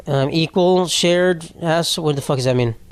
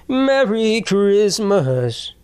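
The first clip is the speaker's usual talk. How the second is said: drawn-out greeting; 4 semitones higher, much slower than usual. Pretend. Drawn-out holiday sign-off.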